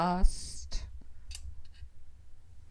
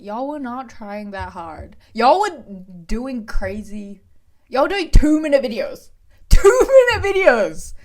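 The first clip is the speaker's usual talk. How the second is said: mock rant; 4 semitones higher, 8 dB louder than usual. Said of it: "I'm like".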